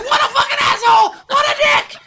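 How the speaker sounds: shrieking high and loud, outraged then savoring; a headless chicken screaming insults at its killer. Acted. Comic bit, a headless chicken shrieking at the killer. Chicken voiced with head cut off.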